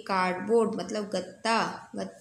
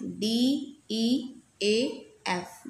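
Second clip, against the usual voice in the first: slow spelling; much slower than usual. Shown as a demonstration. Teacher spelling deaf for students, slow and clear. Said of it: "spells the word".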